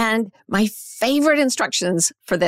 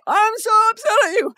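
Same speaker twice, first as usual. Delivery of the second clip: acted upset shout; 13 semitones higher, 3 dB louder than usual. Acting the upset hostage taker.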